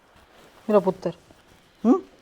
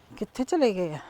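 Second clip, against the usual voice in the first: ordinary talk, nothing marked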